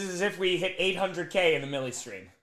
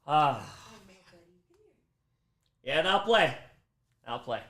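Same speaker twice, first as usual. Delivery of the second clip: ordinary talk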